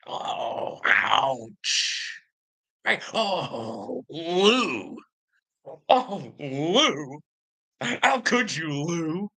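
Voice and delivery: theatrical wounded oh; mock hurt. Real, not acted. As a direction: ham up being hurt. Mock hurt lament to commenter.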